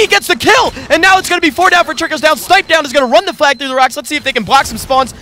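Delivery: high-pitched, thrilled play-by-play; excited kill call. Real, not acted. Caster to viewers as Snipe Down gets the kill, excited. Commentator calls the decisive kill.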